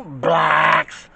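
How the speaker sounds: blacks said low and drawn out; mock-deep blacks; deep emphasis. Shown as a demonstration. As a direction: drop into a heavy chest voice on blacks. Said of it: "I'm just emphasizing it really, really big and deep".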